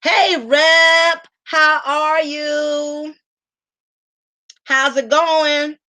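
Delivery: slow drawn greeting. Acted. Reading donor name, drawn out.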